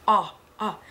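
"Ah, ah" as two separate open vowels; isolated open "Ah", repeated with a gap; short O sound. Shown as a demonstration. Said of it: "Short sound".

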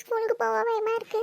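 put-on crying child. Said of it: "இப்படி அழுதுட்டு இருக்கான்".

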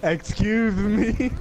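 slow, high mock outrage; mock offended. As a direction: play at being offended.

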